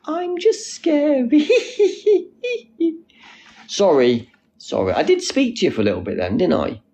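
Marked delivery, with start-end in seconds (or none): 0.1-1.5 slow, very high voice breaking into a laugh; high put-on voice. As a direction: squeal it slowly, then giggle. Puts on high voice, then giggles.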